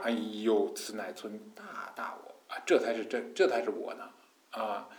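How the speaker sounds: low, hushed mock groan; gritted-teeth mock strain. Mocking someone forcing back tears to keep the self, gritted-teeth strain. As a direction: squeeze it out as if biting back tears. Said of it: "哼，咬牙".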